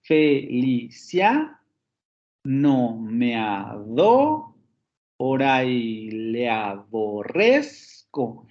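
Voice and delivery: "Felicia no me adora y le aborrezco" very slow, rising on CIA, DO, RREZ; drawn-out metrical reading, "fe-li-CIA, a-DO-ra, a-bo-RREZ-co"; stressed syllables marked. Shown as a demonstration. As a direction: scan each syllable, lifting CIA, DO and RREZ. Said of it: "el último aparece en la décima sílaba".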